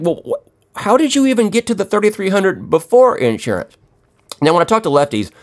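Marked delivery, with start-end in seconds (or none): 0.0-3.8 incredulous. Retold or imitated. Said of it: "but you're like".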